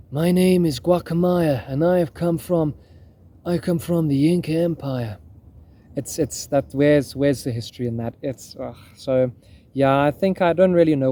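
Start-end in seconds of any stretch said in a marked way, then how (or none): 0.1-5.2 put-on slightly American Hollywood-Inca accent. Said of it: "that Hollywood accent where you speak a bit like an American".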